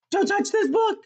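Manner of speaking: high, loud mock warning; gasp, then squeak out the title; playful exclamation. Storyteller signs off to kids with title, high playful warning.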